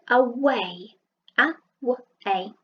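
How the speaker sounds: drawn-out away; slow sounding out. Shown as a demonstration. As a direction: stretch away out slowly. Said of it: "in the middle of a word".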